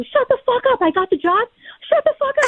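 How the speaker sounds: high, squealing exclamation without much volume; elated disbelief. Retold or imitated. Agent at an open house hears she got the job, high giddy squeal. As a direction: reenact giddy shock, pitch way up.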